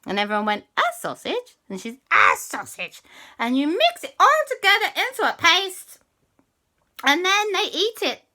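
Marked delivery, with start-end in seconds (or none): 0.8-1.6 incredulous squeak. Acted. Everyone disbelieving. 2.1-8.2 high cat witch. Acted. Cat witch demanding a favour from the children, high and sly.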